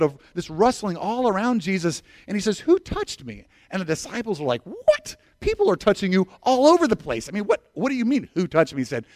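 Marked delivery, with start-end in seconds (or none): 2.6-3.5 urgent question. Retold or imitated. Jesus asking again. 4.6-8.8 incredulous disciples. Retold or imitated.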